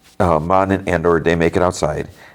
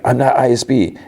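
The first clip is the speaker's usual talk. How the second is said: mock yell; 8 semitones higher than usual. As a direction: imitate someone yelling a denial. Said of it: "And Sulko yells".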